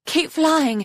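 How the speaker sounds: high, loud urgent push; breathless urging. A narrator's reading. Foeslayer pushing her companion onward under fire, breathless. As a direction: urge them on, breathless. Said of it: "Foeslayer said breathlessly".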